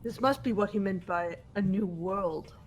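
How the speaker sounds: ordinary delivery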